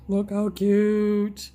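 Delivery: loud, slow, delighted coo; gushing. Real, not acted. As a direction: gush over how cute it is. Gushing over a cute product.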